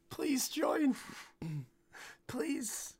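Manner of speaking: whispered, pleading please; mock pleading whisper. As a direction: beg them softly, half joking. Begging listeners to join Discord.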